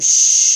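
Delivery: loud, forceful hissed shush; harsh shush. A narrator's reading. Cindy cutting Ralph off, harsh shush. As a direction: shush him sharply and loudly. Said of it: "she said harshly".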